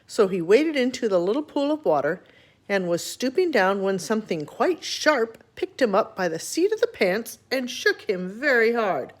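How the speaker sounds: plain reading voice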